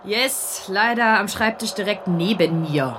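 put-on young detective Busse. Assistant to her boss, sulky about her office mate. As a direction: push the condition up high and bratty. Busse answers the phone call.

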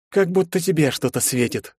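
mildly scornful jab; smirking. A narrator's reading. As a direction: tease him with a smirk. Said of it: "ухмыльнулся Егор".

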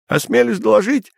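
high eager report; emboldened report. A narrator's reading. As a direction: report with new confidence. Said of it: "приободрившийся Терман".

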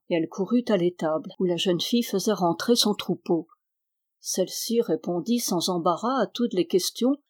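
ordinary delivery

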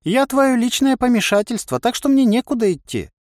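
high-pitched indignant inner voice. A narrator's reading. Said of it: "возмутился голос". (возмущённо, высоким голосом)

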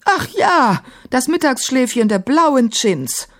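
high-pitched, scornful exclamation; mock the excuse with disdain; sneering grandmother, pitched high; disparaging. A narrator's reading. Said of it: "verzog abfällig die Lippen".

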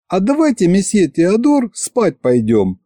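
put-on high, slow sleepy girl. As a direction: coax them sweetly to bed. Маша предлагает спать. (сонный девичий голос)

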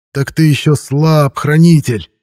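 high, loud gloating villain voice; slow, spiteful howl of triumph; malicious glee. A narrator's reading. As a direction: gloat over weak prey, drawing it out. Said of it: "в ней слышалась злобная радость".

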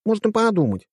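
put-on high scornful girl voice; huffy scoff; snorting. A narrator's reading. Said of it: "фыркнула Машка".

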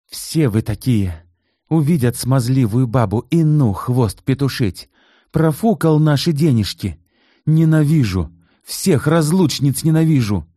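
high, loud jealous tirade; rising to a hateful cry; angry outburst. A narrator's reading. (ревнивая злая тирада, высоко и громко)

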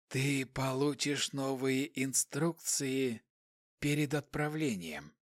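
quiet, slow, emotionless hiss. A narrator's reading. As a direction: hiss it slowly and softly. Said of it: "Шипящий голос не выражал никаких эмоций".

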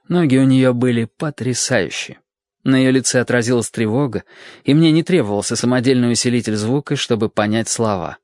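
usual speaking voice

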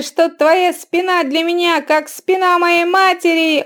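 high, loud, wheedling hawker; loud carnival barker. A narrator's reading. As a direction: talk him into it, eagerly. Barker urges divorce.

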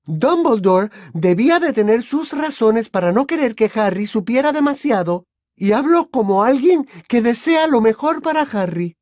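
high, loud, indignant mother voice; aggrieved mother. Acted. Overruled mother speaking for the boy's good, aggrieved.

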